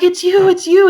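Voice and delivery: put-on high, loud excited young man; excited youth. Young man at the front desk, thrilled, high and loud. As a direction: blurt it out thrilled to see her.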